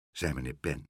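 usual speaking voice

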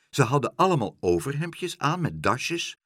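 plain reading voice

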